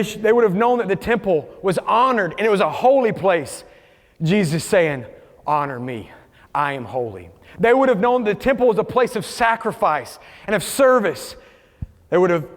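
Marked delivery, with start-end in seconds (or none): 5.5-7.3 low, soft solemn statement; hushed reverence. Retold or imitated. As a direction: voice Jesus with quiet authority. Preacher voices Jesus, hushed.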